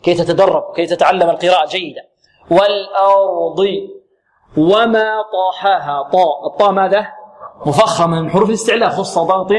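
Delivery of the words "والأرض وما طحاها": slow, drawn-out verse recitation; Quran recitation. Shown as a demonstration. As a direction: recite with long held vowels.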